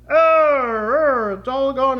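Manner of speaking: loud droning buzzer sound; mock failure noise. Shown as a demonstration. Coach showing the V mistake, mock failure groan. Imitates the gadget failing.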